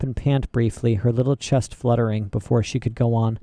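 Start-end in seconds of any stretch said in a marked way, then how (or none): none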